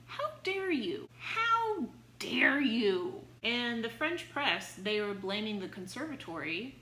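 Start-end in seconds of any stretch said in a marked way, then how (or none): 0.1-1.1 high-pitched imitating "How dare you?"; mock outrage. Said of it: "the press are outraged". 1.2-3.2 slow, louder "How dare you?" stretched out; drawn-out mock outrage. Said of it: "the press are outraged".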